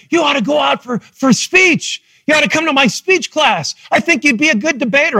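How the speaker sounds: a speech coach urging him to join; coach encouraging. Retold or imitated. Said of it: "He said, he looked at me".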